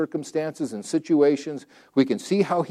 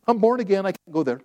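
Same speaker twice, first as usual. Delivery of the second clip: normal talking voice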